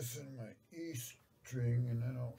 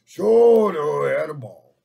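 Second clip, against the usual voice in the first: loud exclamation; 3 semitones higher, 15 dB louder than usual. Real, not acted. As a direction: belt it out like a showman. Exclaiming loudly.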